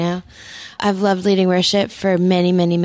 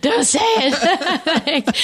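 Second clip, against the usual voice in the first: laughing; 8 semitones higher, much slower than usual. Real, not acted. Echoing host's joke, laughing.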